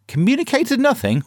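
high disbelieving echo; incredulous. Acted. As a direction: repeat it as if you can't believe it.

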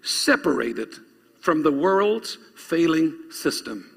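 slow, deliberate phrases with pauses between; measured, emphatic dictation pace. Real, not acted. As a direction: spell it out slowly for note-takers. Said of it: "I want you to write this down".

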